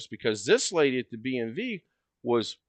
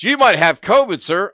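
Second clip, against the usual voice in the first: loud, higher mock clerk yelling across the room; 8 semitones higher, 11 dB louder than usual. Clerk yelling at customer across the lobby, loud and high. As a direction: bellow it from ten feet away. She's sitting in her chair and she does this.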